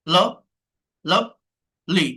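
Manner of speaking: Lovely broken slowly in two; split into syllables. Shown as a demonstration. Teacher asking how many syllables, lovely split apart. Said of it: "কয়টা আছে".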